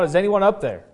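a restrained storyteller's yell; mild retold yell. Stranded man calls up for anyone, mild mock yell. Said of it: "he yelled out".

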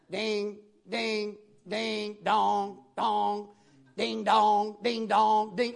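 plodding sung-out notes; monotone ding and dong, note after note; practising ding-dong. Retold or imitated. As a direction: imitate a beginner plinking notes one by one. Mimics a learner practising notes.

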